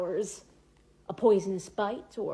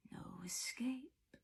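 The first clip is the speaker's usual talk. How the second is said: slow, hushed menacing whisper; sinister voice from the darkness; 5 semitones higher, 13 dB quieter, much slower than usual. A narrator's reading. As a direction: whisper a slow threat from the depths. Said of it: "said a voice in the darkness below".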